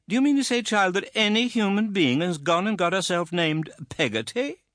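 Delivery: put-on high, loud incredulous old lady; can't believe such a name exists; scandalised aunt. A narrator's reading. Aunt scandalised by the servant's name, high and loud.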